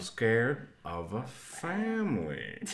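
put-on slow, drawn-out accented line; Winston Duke impression. Repeating the Us line, slow Winston Duke accent. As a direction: stretch family out in his accent. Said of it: "Ooh, that's good".

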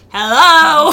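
loud, high call-out, half laughing; hammed-up "hey, I'm right here" shout; mock indignant hello. Mimics men protesting they are present.

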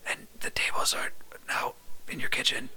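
hushed trailer-style whisper; conspiratorial stage whisper; mock dramatic whisper. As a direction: whisper it as a shocking twist. Whispered twist game.

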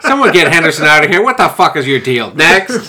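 mock exasperated boss. Boss rejecting a first-draft spy plan, mock exasperated. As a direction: throw out a bad pitch in pretend outrage.

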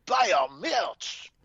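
put-on high, slow French accent, falling; slow hammy French shout-out. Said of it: "did I turn on the French version of it?".

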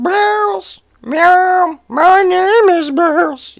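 high, drawn-out meowing voice; Bouse the cat. Retold or imitated. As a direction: yowl the words like a cat. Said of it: "he'll make his sounds".

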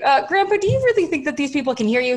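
high, quick skeptical question; high-pitched polite disbelief; doubting grandchild. Retold or imitated. Acting out questioning her grandpa.

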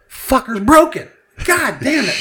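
high-pitched acted frustration; exasperated outburst. Acting out failed card machine.

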